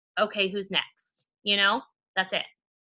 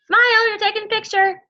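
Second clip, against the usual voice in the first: much higher and louder, calling to everyone; cheerful call-out; 11 semitones higher, 7 dB louder than usual. Real, not acted. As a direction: sing it out to the whole call. Calling out to the group.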